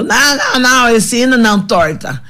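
tipsy, high protesting voice, slowed on the last line; a drunk mother waving off the hymn; mock drunken mother's plea. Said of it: "a minha mãe falou".

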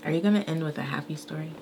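soft, quick, half-whispered question; hushed aside. Real, not acted. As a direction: ask it quickly under your breath. Quiet aside before her story.

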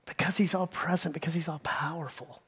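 breathy whispered awe. Real, not acted. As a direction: hush it like a secret. Sermon opening hushed repetition.

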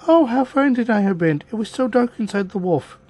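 put-on high-pitched girlish exclamation; girl crying out. A narrator's reading. Girl freed from inside the wolf, high cry. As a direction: exclaim it high, as a child just rescued.

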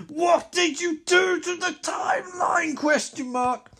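angry hero yelling at his nemesis; mega angry. Acted. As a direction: scream it at the villain in rage. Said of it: "mega angry".

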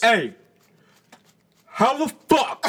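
high, loud mock preacher yell; pastor preaching. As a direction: belt it out like a pulpit preacher. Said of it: "It was like the pastor preaching".

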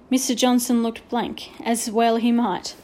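ordinary delivery, nothing marked

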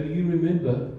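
normal talking voice

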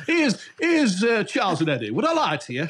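nasal, thick Yorkshire link, high on the start; old Yorkshire DJ voice. Retold or imitated. DJ imitating his old nasal Yorkshire radio link. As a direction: mimic your young self on local radio. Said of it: "'cause I was talking like that, the nose".

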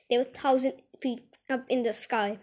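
plain reading voice

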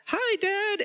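high, sly put-on voice for Dad; sneaky fake greeting. Jacob fakes Esau to blind father.

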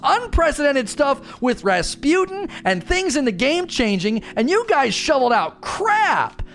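high, mock-furious rant that ends in a shout; livid story dev. A fan as the story team ranting at others, high mock fury. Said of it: "If I was on the story team, I would be livid".

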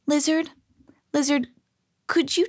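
high, hushed appeal; high-pitched, hesitant calling; tentative plea. A narrator's reading. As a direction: call it gently, pausing between the words. Hesitant plea to magic lizard.

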